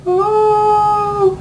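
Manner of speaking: high, drawn-out mock moan; a hammed-up faraway ooh; mock distant moan. Mario hears Peach faintly from the distance, a high mock moan.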